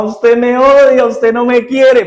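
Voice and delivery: put-on a boy crying out at his mother while she disciplines him, high and drawn out; spoiled child's wail. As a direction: whine it like a kid throwing a tantrum in the garage. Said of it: "él empezaba a gritar".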